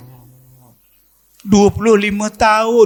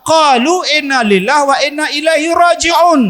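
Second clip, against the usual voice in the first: preached recitation; 7 semitones higher than usual. Acted. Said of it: "reciting verse at full force".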